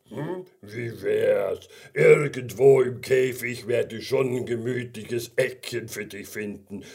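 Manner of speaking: slow, drawn-out bear voice; big friendly bear. Acted. Brown bear invites gummy bear to stay, slow bear voice. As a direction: hum and rumble slowly like a contented bear.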